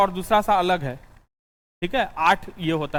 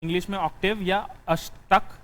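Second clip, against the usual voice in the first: dictating notes. Acted.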